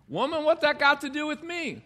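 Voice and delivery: high-pitched, indignant mock retort; mock harsh tone. Preacher playing Jesus snapping at his mother, mock harsh. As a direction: throw it back sharply, as if affronted. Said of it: "I don't think he said it in that tone".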